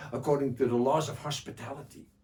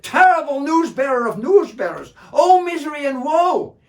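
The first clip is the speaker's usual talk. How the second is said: high, loud wailing cry of grief; wailing lament; 13 semitones higher, 16 dB louder than usual. Acted. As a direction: scream out your misery, tearing your hair. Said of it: "screaming and yelling".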